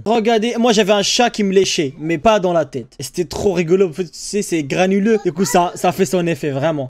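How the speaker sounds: normal talking voice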